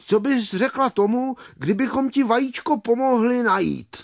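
high, lively little helper voice; eager bumblebee. Acted. Brunda offering to find the egg, eager suggestion. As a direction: pitch it up and offer help brightly.

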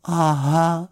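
small, high, sheepish bear voice; deflated little bear; crestfallen. A narrator's reading. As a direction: let it sink in, high and drawn out. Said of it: "řekl Paddington schlíple".

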